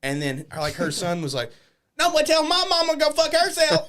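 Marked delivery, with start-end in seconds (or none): 2.0-3.9 high, loud mock voice of her son; imitated yelling kid. Woman's son heckles wrestler, high imitated yell. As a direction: yell it up high like an outraged child.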